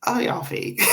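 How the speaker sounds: breaking into high laughter; airy laughing call-out. Real, not acted. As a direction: laugh it out in disbelief. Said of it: "laughing at the fake goodbye".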